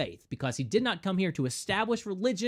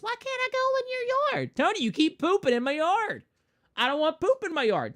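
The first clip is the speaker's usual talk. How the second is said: put-on much higher, childlike whine; high kid voice; 13 semitones higher, 3 dB louder than usual. Neighbor kid Tony asking why, high childish voice. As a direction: squeak it like a little kid.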